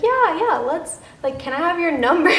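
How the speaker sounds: high, loud burst of nervous agreement. Retold or imitated. Asking a new crush for their number, nervous. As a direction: blurt it out, jittery and over-eager. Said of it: "I was, like, really nervous".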